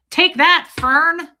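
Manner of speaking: loud mocking taunt; taunting yell. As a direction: shout it at her gleefully. Taunting Fern in retelling.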